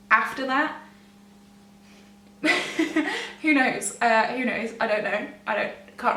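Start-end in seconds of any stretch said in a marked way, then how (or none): 2.4-3.4 nervous laugh. Real, not acted. Laughs at the memory.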